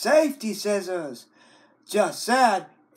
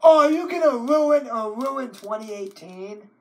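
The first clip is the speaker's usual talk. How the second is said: mock shock; 6 semitones higher, 6 dB louder than usual.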